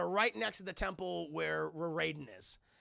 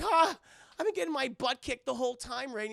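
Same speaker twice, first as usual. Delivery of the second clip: exasperated complaint; 6 semitones higher, 5 dB louder than usual. Retold or imitated. Liu Kang complains to Raiden.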